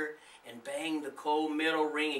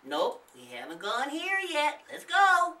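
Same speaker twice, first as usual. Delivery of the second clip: put-on higher, louder cheeky boy voice; playful boy; 12 semitones higher, 7 dB louder than usual. A narrator's reading. Nathan grinning at the big house, playful boy voice. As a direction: tease them into going. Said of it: "Nathan said, smiling".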